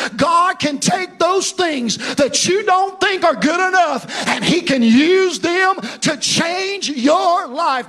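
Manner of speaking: loud, high, half-sung preaching; preaching at full force. Acted. Pastor urging his congregation to stay faithful, loud ringing preaching. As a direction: ring it out over the congregation like a revival.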